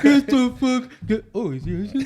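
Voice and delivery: high, loud garbled yell; hammed-up mock deaf voice; deaf mother-in-law. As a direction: imitate her muffled holler breaking off into a question. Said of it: "do her voice".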